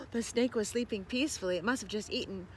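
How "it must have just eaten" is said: plain reading voice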